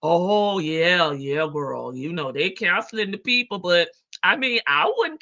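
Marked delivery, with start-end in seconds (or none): none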